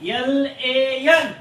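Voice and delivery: slow, letter-by-letter spelling; measured classroom spelling, each letter separate. Shown as a demonstration. Teacher spells word for class.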